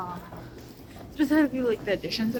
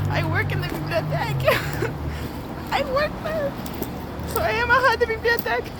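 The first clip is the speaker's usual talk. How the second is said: high giggly voice laughing; 12 semitones higher than usual. Real, not acted. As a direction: laugh through the words, delighted.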